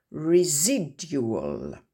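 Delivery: slow Residual, stress on SI. Shown as a demonstration. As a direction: lean on SI in Residual. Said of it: "l'accent tonique se trouve sur la syllabe d'avant". (accent tonique sur SI dans Residual)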